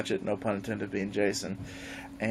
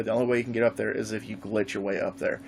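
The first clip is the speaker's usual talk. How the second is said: usual speaking voice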